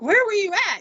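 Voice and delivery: very high-pitched retold demand; urgent, squeaked-up question; frantic question. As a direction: imitate a frantic husband, pitch shooting up. Frantic husband questions wife.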